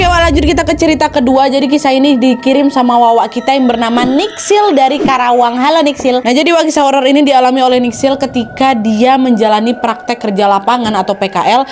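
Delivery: plain reading voice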